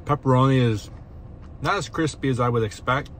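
chewing between words; speaking around a food bite; mouth partly full of pizza. Real, not acted. Seen 1: Bites at -4, jaw and lips show chewing between spoken words.

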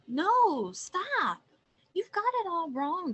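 high, loud protest; crying out. A narrator's reading. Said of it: "cried the observant owl".

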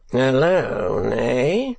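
mild mock surprise; affected surprise. Sir Mulberry reacting to a request for privacy, mildly affected surprise. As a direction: feign a little surprise. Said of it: "in affecting surprise".

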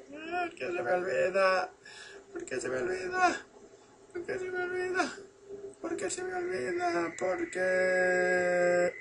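soft, very high mock whine; lamento en falsete. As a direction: wail it quietly in falsetto. Mock lament over forgetting. (lloriqueo fingido, muy agudo y flojito)

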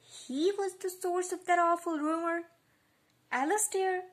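high, loud shocked question; surprised. A narrator's reading. Lucy learns who started the rumour, shocked. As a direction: blurt it out in disbelief, pitch jumping up. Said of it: "said to her own surprise".

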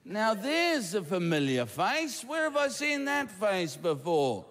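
mock puzzling over a familiar face; puzzled onlooker. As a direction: ponder it with a long hmm, hammed up. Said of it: "you'll be going".

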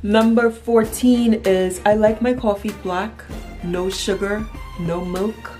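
normal talking voice

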